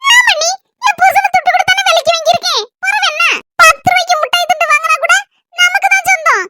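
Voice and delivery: high-pitched complaining family figure; self-righteous sister-in-law. Acted. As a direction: argue your claim in a high voice. Plays the husband's sister. (உயர்ந்த குரலில் வாதாடும் நாத்தனார்)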